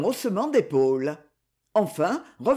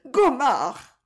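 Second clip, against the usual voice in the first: cry for Gomard; 6 semitones higher than usual. Acted. Woman cries out to Gomard, alarmed cry. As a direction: call out to him in alarm. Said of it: "cria-t-elle".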